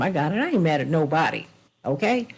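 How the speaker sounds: normal talking voice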